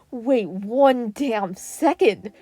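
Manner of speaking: slow loud disbelief; emphatic realization. Acted. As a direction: drag each word as it dawns. Uraraka realization, louder.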